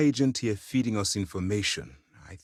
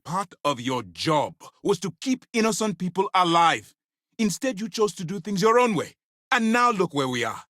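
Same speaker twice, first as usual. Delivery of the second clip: raised, higher-pitched scolding; angry rebuke; 9 semitones higher, 4 dB louder than usual. Acted. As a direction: dress down a subordinate who disobeyed orders.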